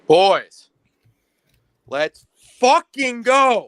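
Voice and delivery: slow drawn-out rallying shout; hype shout. Real, not acted. Caller hypes the team.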